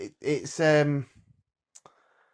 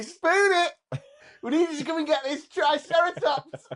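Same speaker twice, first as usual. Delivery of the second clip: excited shout; 11 semitones higher than usual. Retold or imitated. Imagined kid shouting.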